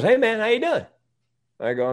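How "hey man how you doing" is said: much higher, bright friendly hello; upbeat higher-pitched young Uber driver; cheery driver greeting. Retold or imitated. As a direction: chirp it like a chatty young driver. Driver greets him, retold.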